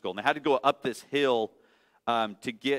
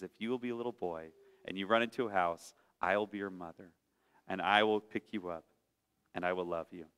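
lower, softer, fast tender mother; gentle mother bunny; 6 semitones lower, 9 dB quieter, faster than usual. Acted. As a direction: reassure the runaway child quietly.

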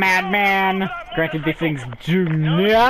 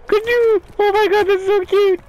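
very high, delighted squeal; gushing; 4 dB louder than usual. Real, not acted. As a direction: squeal over something adorable. Gushing over a cute dog.

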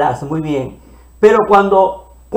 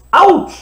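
mock ouch; 10 semitones higher, 4 dB louder than usual. Card game pain cry.

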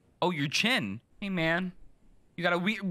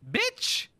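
squealed insult; 3 dB louder than usual. Acted. Insult after delivery.

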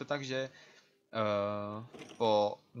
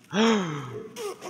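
surprised gasp; 7 semitones higher, 6 dB louder than usual. Real, not acted. Surprise ambush.

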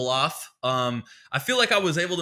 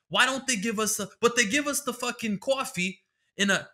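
lightly voiced cranky old man, pitched up; a hint of an old New Yorker ranting about straws; old New York guy yelling; 8 semitones higher than usual. Comic imitating an old man on Twitter, mildly yelling. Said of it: "this old man, and he was just kinda from New York, and he was just yelling".

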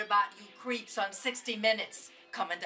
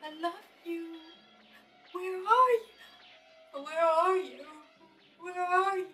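grieving; 8 semitones higher than usual. Acted. Widow grieving, calling out.

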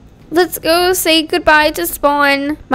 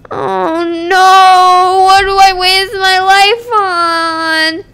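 louder, higher hammed-up lament; mock lament; 5 semitones higher, 4 dB louder, slower than usual. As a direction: wail about wasting her life. Lamenting wasted work.

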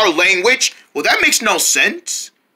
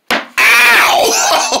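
high, loud "Ow!" with a laugh in it; pained yelp; 13 semitones higher, 3 dB louder than usual. Acted. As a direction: yelp as the bat lands on you. Toy hit on the nose.

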